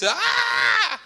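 high-pitched imitated scream, not loud; held, hammed-up shriek; mock scream. Preacher imitating injured lady on the ground, screaming. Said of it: "She's screaming her head off".

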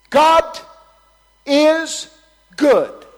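God is good, three booming shouts; shouted proclamation. Real, not acted. Preacher to congregation, ringing shouted proclamation.

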